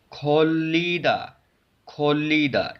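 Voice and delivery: खोलीदा drawn out on one L; liquid L sound. Shown as a demonstration. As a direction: hold the joined ल in खोलीदा. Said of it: "तो लाम की आवाज़ निकलती है". (खोलीदा, लाम की लंबी आवाज़ से)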